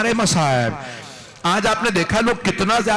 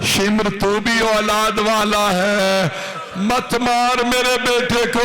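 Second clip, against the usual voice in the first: mother's plea; 4 semitones higher, slower than usual. A narrator's reading. Mother begs killer to spare son.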